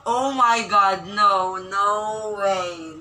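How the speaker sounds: loud, stretched-out no way; can't believe what she sees; shocked disbelief. Real, not acted. Vlogger gasping at the screen in loud disbelief.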